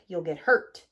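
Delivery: a big truck warning him off; rumbling. A narrator's reading. Dump truck rumbling a warning to bulldozer. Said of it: "rumbled Dump Truck".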